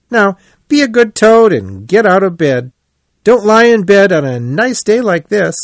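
high, brisk-spirited storybook friend; cheerful Rat. A narrator's reading. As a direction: coax a moping friend in a high, lively voice.

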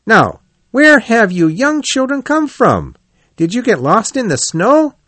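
high, lively questioning voice; cheerful Rat character. Acted. Rat questions the young hedgehogs.

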